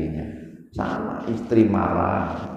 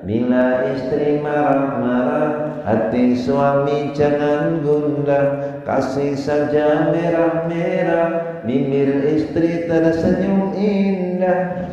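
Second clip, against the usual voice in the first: slow, higher sing-song rhyme; recited pantun; 7 semitones higher, slower than usual. Acted. Preacher reciting a rhyme to husbands, slow sing-song. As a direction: lilt it like a playful verse.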